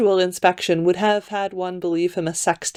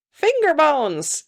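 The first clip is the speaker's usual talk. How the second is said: high, slow lady; name it slowly in a high lady's voice; 8 semitones higher, slower than usual. A narrator's reading. Lady offering payment for a skull, high slow voice. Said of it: "came a lady's voice".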